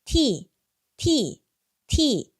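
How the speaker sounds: 티 repeated clearly; model word. Shown as a demonstration. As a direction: say 티 crisply for students. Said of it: "차라는 뜻의 단어가". (또렷한 티 반복)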